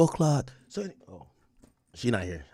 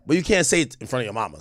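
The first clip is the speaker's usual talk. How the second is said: usual speaking voice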